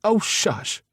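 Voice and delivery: high, mock-scolding shush; a fond mother's scold; mother scolds her daughter, teasing. A narrator's reading. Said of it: "a scolding look".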